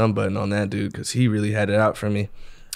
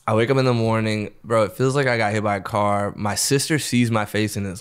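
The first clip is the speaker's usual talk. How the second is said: ordinary talk, nothing marked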